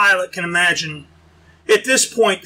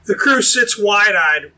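ordinary delivery, nothing marked

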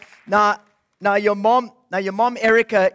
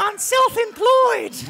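much higher, slow puppet wolf drawl; 11 semitones higher, slower than usual. Acted.

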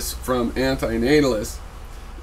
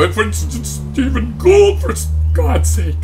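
high, loud mock exasperation; mocking imitation; 10 semitones higher, 7 dB louder than usual. Vlogger mimics an opponent arguing from authority, mocking and high. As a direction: mimic an opponent in a raised, strained voice. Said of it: "and then you go".

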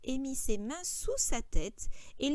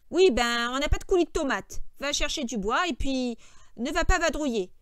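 louder, higher, testy orders; irritated George; 3 semitones higher, 9 dB louder than usual. A narrator's reading. George sending Lenny off for wood, irritated. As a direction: snap back impatiently.

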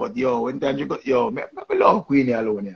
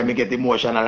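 normal talking voice

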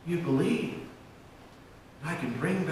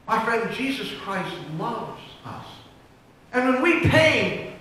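ordinary delivery

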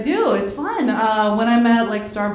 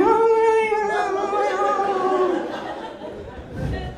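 slow, sing-song dismay; mortified; 8 semitones higher, much slower than usual. Retold or imitated. Comic mortified watching her friend's act, slow sing-song. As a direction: drag it out in embarrassed disbelief. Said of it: "I'm like".

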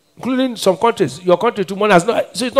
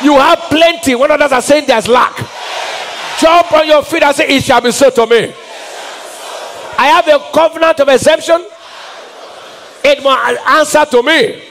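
full-force preaching; 7 semitones higher than usual. Acted. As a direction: preach it over them with rising fire.

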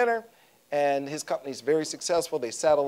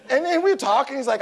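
ordinary delivery

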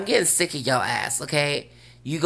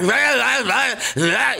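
high, louder mock ranting noise; 9 semitones higher, 5 dB louder than usual. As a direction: ham up going off at someone. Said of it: "and I'm doing the most".

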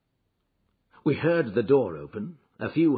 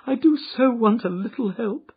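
high, loud begging for help; desperate plea; 12 semitones higher, 8 dB louder than usual. Acted. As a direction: plead as if close to tears. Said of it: "she cried".

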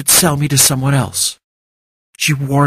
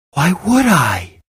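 incredulous outburst; 5 semitones higher, slower than usual. A narrator's reading. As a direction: refuse the very idea, stretched out. Incredulous refusal to forgive.

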